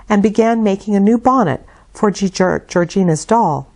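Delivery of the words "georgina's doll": plain reading voice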